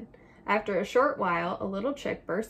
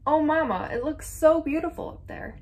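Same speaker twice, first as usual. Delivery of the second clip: high, wondering exclamation; storybook chick's thrilled outburst; excited cry; 8 semitones higher, 4 dB louder than usual. A narrator's reading. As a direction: gush in delight, voice lifted. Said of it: "Little Chick cried".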